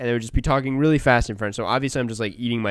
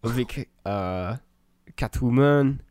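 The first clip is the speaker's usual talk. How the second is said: slow, mock French accent; hammed-up French accent, halting between names; 3 semitones higher, 4 dB louder, slower than usual. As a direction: draw out the names in playful French. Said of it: "say names with a French accent".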